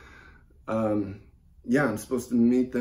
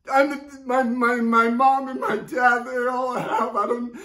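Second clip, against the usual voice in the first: high-pitched, faltering grief; distraught; 9 semitones higher than usual. Acted. Caller explaining parents are all they have, distraught. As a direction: stammer through mounting grief.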